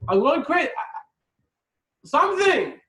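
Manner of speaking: high, loud, frantic rant; starving guest losing patience, loud and high; exasperated rant. Retold or imitated. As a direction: build a hungry man's frustration. Starving gentile ranting.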